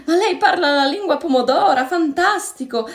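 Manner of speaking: high, loud, delighted outburst; overjoyed exclamation. A narrator's reading. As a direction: burst out with wide-eyed joy. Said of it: "esclamò con gli occhi sbarrati di gioia". (esclamazione piena di gioia)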